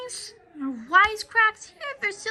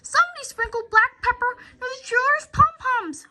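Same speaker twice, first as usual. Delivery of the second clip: higher, louder excited fan voice; indignant fan; 8 semitones higher, 5 dB louder than usual. Acted. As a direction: call it out across the stands. Fan shouting accusation.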